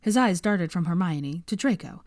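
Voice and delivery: usual speaking voice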